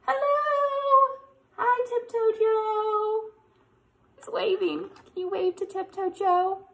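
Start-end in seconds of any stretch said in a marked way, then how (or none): 0.1-3.3 squeaky high bear greeting, drawn out. Acted. Bear greets the children in a high, slow voice. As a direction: play Joe the bear saying hi, high and slow.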